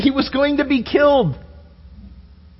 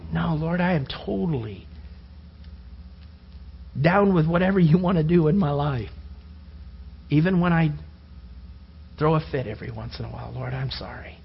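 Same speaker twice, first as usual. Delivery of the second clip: humble prayer; 6 semitones lower, 8 dB quieter than usual. Retold or imitated. Humble reply to God.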